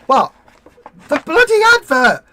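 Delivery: loud, high exasperated shout; exasperated shouting at partner; mock yell at Rufus. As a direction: yell across the room, fed up. Yelling at partner over advert.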